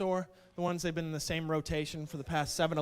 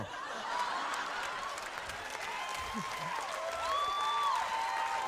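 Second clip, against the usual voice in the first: high cackle. Real, not acted. Long laugh at own joke.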